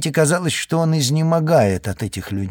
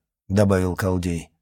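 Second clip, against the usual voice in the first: plain reading voice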